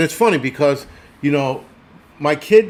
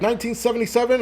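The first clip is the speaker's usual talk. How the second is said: "Nineteen seventy-seven?" called out much higher with a rising tune, like a guard calling an inmate; mother calling the roll; 9 semitones higher, faster than usual. Retold or imitated. Correction officer mother summoning her son, higher rising call. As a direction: bark the number up high like a correction officer. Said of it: "she'd be like".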